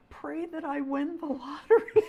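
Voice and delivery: high, breaking into laughter; laughing punchline. Real, not acted. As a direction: crack up at your own joke. Punchline of her funny story.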